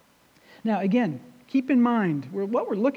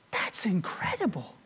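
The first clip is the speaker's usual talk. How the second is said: hushed, whispered amazement; awed whisper; 9 dB quieter than usual. Real, not acted. Preacher marvelling at Jesus' words to congregation, awed whisper. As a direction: drop to a whisper in awe. Awed aside after scripture reading.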